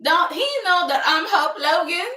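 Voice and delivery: loud, high, affronted outburst; mock indignation. Host as Hope after being hung up on, mock indignant. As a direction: play up the offended self-importance.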